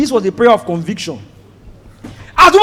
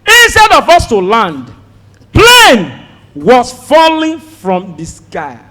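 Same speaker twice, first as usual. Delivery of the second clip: usual speaking voice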